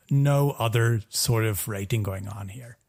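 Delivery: normal talking voice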